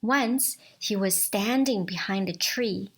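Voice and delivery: ordinary delivery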